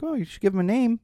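high, gentle parent voice; imitated singsong encouragement; parent coaxing child. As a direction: coax a small child, pitched right up.

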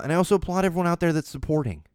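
ordinary talk, nothing marked

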